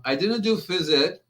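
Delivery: ordinary talk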